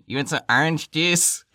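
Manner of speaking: high, nasal Michigan imitation; nasal Michigan accent. Mimicking Michigan people offering juice, nasal accent. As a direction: push it through the nose, Michigan style. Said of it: "talking through their nose".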